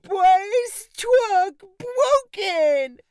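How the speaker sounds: put-on high, slow toddler lament; little boy mourning his broken truck; upset toddler. A narrator's reading. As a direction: drag it out like a heartbroken little boy. Bryce distraught over broken toy.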